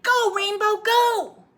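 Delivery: high, loud shout to the horse; shouted urging. Acted. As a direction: yell the horse on to run. Boy urging horse in race.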